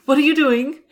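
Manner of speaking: high, loud imitation of a panicked kid; mock alarmed child. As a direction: cry out like a child fearing for her toys. Imitating frightened daughter hearing trash bag.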